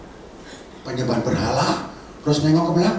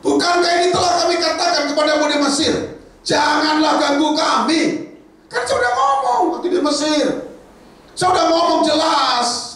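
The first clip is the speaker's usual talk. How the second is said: angry shout; 12 semitones higher, 4 dB louder than usual. Acted. Israelites yelling at Moses, loud and high. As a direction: yell it back at him. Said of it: "Dia tidak kepahitan".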